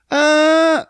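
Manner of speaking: high, loud fake-crying wail; mock wail. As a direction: let out a hammed-up sob. Mock wail over trivial trouble.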